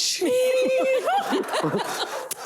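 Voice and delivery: long high-pitched laugh. Real, not acted. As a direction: laugh at the heat. Laughs at everything being hot.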